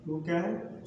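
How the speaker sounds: usual speaking voice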